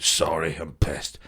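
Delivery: put-on low, soft drunken mumble on repeat; muttered, looping tipsy apology; drunk apologiser. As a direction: imitate a drunk woman apologising under her breath. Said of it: "it was just on a repeat".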